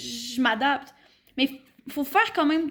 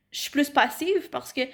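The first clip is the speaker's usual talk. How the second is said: ordinary talk, nothing marked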